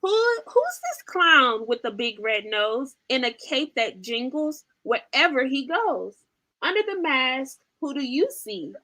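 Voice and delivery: lively, sing-song storybook reading; animated picture-book read-aloud; reading to children. Acted. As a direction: read it like bedtime for toddlers.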